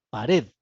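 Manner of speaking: "pared" with the stress on "red"; stress on second syllable. Shown as a demonstration. Teacher shows students the tonic vowel, "pared" stressed on its last syllable. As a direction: lean on the E of "pared", leaving "pa" unstressed. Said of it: "la tónica es la E".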